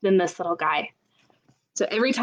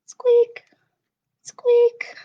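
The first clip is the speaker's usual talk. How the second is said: very high, tiny squeak; mouse squeak; 14 semitones higher, 5 dB louder than usual. Shown as a demonstration. Librarian voicing a mouse for kids, tiny high squeak. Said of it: "All I know is mice go".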